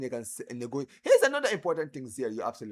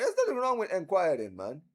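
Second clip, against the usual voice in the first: normal talking voice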